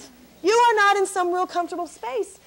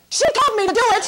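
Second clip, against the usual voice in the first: blurting blame; 5 semitones higher, 5 dB louder, faster than usual. Retold or imitated. Seatmate blaming the comic.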